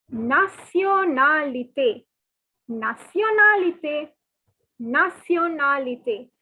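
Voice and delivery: nationalité drawn out slowly, na-sio-na-li-té; slow syllable-by-syllable nationalité. Shown as a demonstration. Teacher slowly models nationalité for the class. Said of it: "the pronunciation is completely different".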